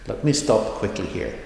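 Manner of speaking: ordinary talk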